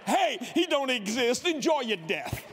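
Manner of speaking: slow gloating taunt; preacher taunting the atheist, gloating; mocking taunt. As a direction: rub it in, relishing it.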